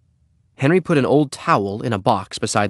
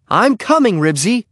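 high, loud call to a dog; 12 semitones higher, 5 dB louder than usual. A narrator's reading. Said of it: "Henry called".

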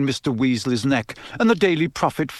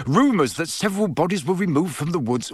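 higher-pitched, incredulous quoting; exasperated; 4 semitones higher than usual. A narrator's reading. Father repeating the article's claim, exasperated. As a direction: quote it back, fed up. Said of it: "said Mister Weasley in exasperation".

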